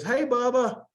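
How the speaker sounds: high-pitched friendly hail; calling out a greeting. Retold or imitated. Bar patrons greeting a regular as they walk in, calling out.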